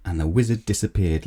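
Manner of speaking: ordinary delivery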